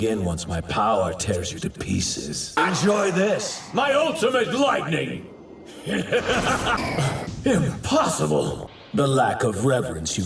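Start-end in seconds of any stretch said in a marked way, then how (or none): 2.6-5.3 high, exultant battle cry; attack call. Acted. Calling out an attack. 5.9-7.4 high gloating laugh; villain laugh. Acted. Villain laughs after attacking.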